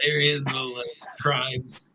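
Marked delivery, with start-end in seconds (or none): none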